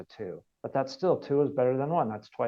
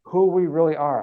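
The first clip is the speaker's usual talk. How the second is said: louder, higher emphatic echo; emphatic repeat; 4 semitones higher, 8 dB louder than usual. Real, not acted. Teacher repeats to listeners, louder and emphatic. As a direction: drive the point home.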